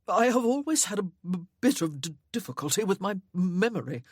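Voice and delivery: a meek, hammed-up stammer on b- and d-; husband plays up his stammer to make light of it; exaggerated stammer. A narrator's reading. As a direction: trip over the b- and d- on purpose, meekly. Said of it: "deliberately exaggerating his stammer".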